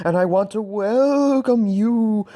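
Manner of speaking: slow, eerie, wavering ghost voice. Pretend. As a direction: moan it out like a spooky ghost. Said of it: "that ghostly voice said".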